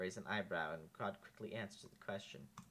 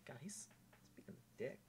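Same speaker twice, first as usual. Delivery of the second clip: whispered aside; whispering; 4 semitones higher, 9 dB quieter than usual. Real, not acted. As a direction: whisper it under your breath. Aside about the character.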